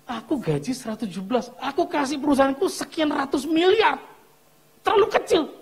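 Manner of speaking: increasingly high-pitched boast; mock cocky. Young man telling pastor his salary is too small, cocky.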